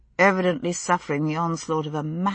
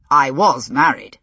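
sarcastic; 4 semitones lower, 6 dB louder than usual. A narrator's reading. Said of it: "he pointed out with some sarcasm".

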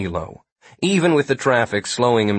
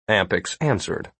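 usual speaking voice

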